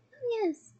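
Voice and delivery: high, happy reply. A narrator's reading. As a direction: say it happily, pitch lifted. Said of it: "she said happily".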